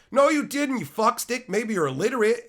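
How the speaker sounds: high, loud scolding shout; yelling insult. Real, not acted. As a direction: bark it at the chatter. Streamer yelling at chatter.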